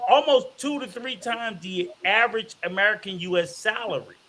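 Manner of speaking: normal talking voice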